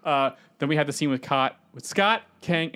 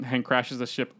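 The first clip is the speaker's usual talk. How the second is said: ordinary talk, nothing marked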